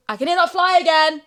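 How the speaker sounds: high and loud alarm; startled by the buzz; sudden loud alarm; alarmed exclaim. Real, not acted. Hears fly again.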